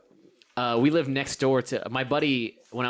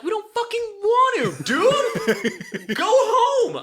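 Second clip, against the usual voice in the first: much higher, louder laughing yell; laughing mock shout; 7 dB louder, much slower than usual. Kids yelling at neighbor kid, laughing shout. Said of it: "we'd be like".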